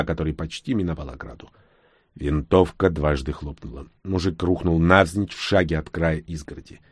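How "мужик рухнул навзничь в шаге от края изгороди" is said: usual speaking voice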